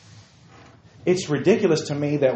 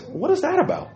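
ordinary delivery

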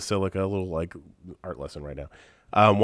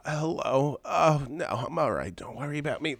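feeble mother impression, fading softer; imitating mom; 2 semitones higher, 4 dB quieter than usual. Shown as a demonstration. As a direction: sound like a dying, self-sacrificing mother. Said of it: "That's how she sounds".

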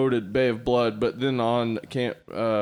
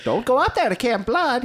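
high-voiced crazy old man; 10 semitones higher than usual. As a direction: warn them like the crazy town prophet. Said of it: "He has a real high voice".

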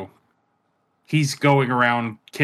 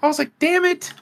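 much higher, frustrated exclamation; high-pitched; 4 dB louder than usual. Retold or imitated. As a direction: pitch it way up in mock despair. Repeats reaction, much higher.

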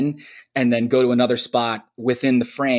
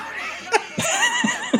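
high squealing laugh. Real, not acted. Laughing at own impression.

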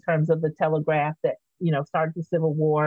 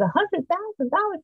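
much higher, incredulous on dollars; disbelief; 13 semitones higher than usual. Retold or imitated. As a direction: echo the price as if you can't believe it. Retelling her shock at price.

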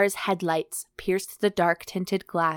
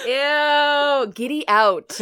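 mock disgust; 7 semitones higher, 6 dB louder, much slower than usual.

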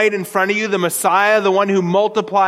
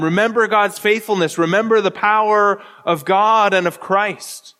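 usual speaking voice

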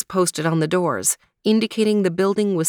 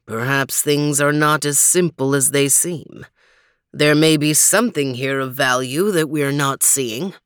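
much lower, thoughtful big dog; deep dog voice; 4 semitones lower than usual. Acted. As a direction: ponder it low and calm. Overton reasons.

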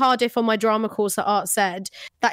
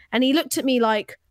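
ordinary talk

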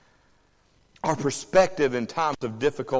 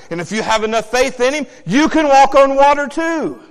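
loud, high, driving sermon voice; full-force Sunday preaching; fiery preaching; 8 semitones higher, 8 dB louder than usual. Acted. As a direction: build the congregation up, shouting.

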